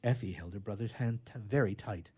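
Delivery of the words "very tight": ordinary delivery, nothing marked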